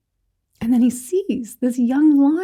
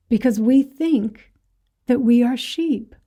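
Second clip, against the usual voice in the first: ordinary talk, nothing marked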